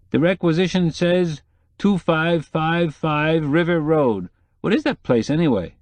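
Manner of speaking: lightly voiced hungry photographer; photographer's voice. Odd Bunsen asks about address.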